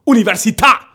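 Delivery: "università" shouted, last syllable punched; loud final accent. Shown as a demonstration. Showing friends how Italians stress words, "università" with a shouted final syllable. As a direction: hit the end of "università" high and loud. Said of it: "tu prononces fort quand tu dis".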